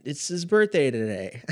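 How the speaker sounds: high-pitched mock justification; retold gleeful comeback. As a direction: act out a cheeky kid's excuse.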